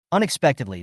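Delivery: ordinary delivery, nothing marked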